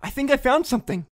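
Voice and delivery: high, loud, urgent shout; excited shout. A narrator's reading. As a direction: shout the discovery to wake a friend. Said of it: "I shouted at the Colonel".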